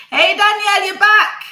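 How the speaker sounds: loud, high happy hello; excited greeting. Real, not acted. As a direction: call out gladly to her. Guest reconnects to the stream.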